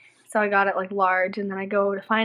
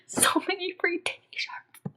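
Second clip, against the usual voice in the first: slow, drawn-out whisper; hushed relish, stretched out; gleeful whisper; 10 semitones higher, 4 dB quieter, much slower than usual. Retold or imitated. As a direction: whisper it slowly, savoring the haul. Gleeful over repeated prize wins.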